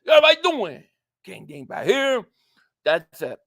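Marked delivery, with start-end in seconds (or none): 0.0-2.2 high, loud, words run together, then dropping; really fast intro. Shown as a demonstration. Streamer showing how the intro really goes, really fast. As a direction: rattle off the catchphrase in one burst. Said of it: "But it's really, really fast".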